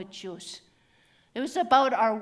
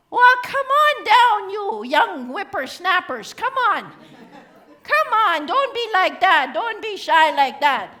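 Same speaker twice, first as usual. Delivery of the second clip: loud, high-pitched elder rounding up youngsters; calling out; 6 semitones higher, 8 dB louder than usual. Acted. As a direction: coax shy volunteers down, loud and insistent. Calls reluctant volunteers down.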